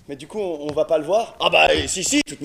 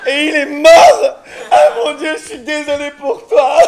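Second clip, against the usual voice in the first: melodramatic; 5 semitones higher, 6 dB louder than usual. Acted. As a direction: overact a tragic death. Improvised melodrama, character dies.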